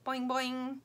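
high springy boings; bouncing noise. A narrator's reading. Round thing wiggles in the bag, springy. Reader imitates a bouncing ball.

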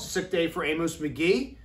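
usual speaking voice

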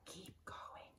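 soft urgent whisper; boy whispering encouragement to his sister. Acted. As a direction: whisper so the dinosaur won't hear. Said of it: "Jack said softly".

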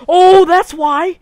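loud, slow sudden realization; loud surprised realization. Real, not acted. Spots the spawned cows.

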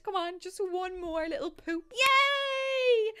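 high, loud squeal of joy at the finish; excited cheer. Real, not acted. Gamer cheering her Sim child, high delighted squeal. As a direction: cheer the little one over the line, rising to a shriek. Cheering the Sim's potty success.

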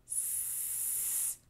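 a long unvoiced snake hiss; s held as a smiling hiss; hissing s sound. Shown as a demonstration. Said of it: "starts with the sound".

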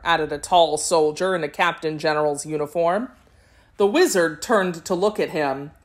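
ordinary delivery, nothing marked

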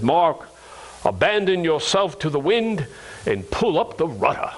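put-on Massachusetts-Kennedy accent with dropped r's; Dr. Sequeira's JFK-like accent. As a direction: imitate a professor's JFK-style New England accent. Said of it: "in his Massachusetts, California accent, he sounded like J F K".

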